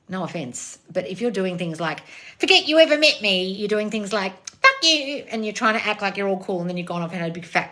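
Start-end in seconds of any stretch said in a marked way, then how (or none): 2.4-3.4 high, loud mock drama; mocking dramatic quote. 4.5-5.2 higher, a touch louder; mock the angry text; mocking outburst.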